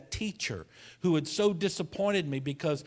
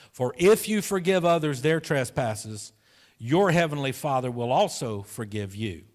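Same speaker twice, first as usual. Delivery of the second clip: louder, measured Bible reading; scripture reading; 4 dB louder than usual. Acted. Pastor reads Matthew six to the congregation, declaiming. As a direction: proclaim the verse to the church.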